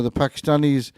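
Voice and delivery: ordinary talk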